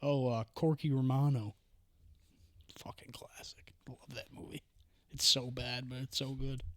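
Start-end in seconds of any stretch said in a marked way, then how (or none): 2.8-4.6 very soft, near-whispered fondness; barely voiced, reverent little aside; hushed aside. Real, not acted. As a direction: drop to a murmur, as if to yourself. Fond aside about a favorite movie.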